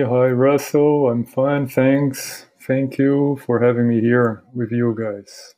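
ordinary talk

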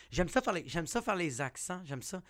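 ordinary talk, nothing marked

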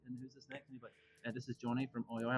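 hushed whisper-like question; quiet aside. Retold or imitated. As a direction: lean in and ask very quietly. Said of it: "and I'll be like".

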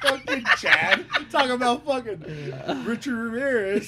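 laughing out loud and slow. Real, not acted. Friend teases about shout-outs, laughing out loud. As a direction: crack up at the joke.

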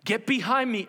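high, emphatic dismissal; stern rebuke. Acted. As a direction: order him back with force. Acting Jesus rebuking Peter.

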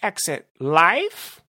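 high villain voice, slowing for effect; theatrical villain threat; gloating villain. Acted. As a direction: deliver the threat with relish. Narrator voices Rumble.

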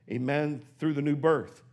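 normal talking voice

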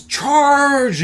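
loud, high, drawn-out cry; imitated battle yell. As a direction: yell it like a man leading a charge. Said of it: "and he yells".

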